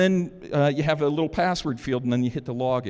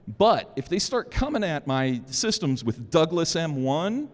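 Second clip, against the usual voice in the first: usual speaking voice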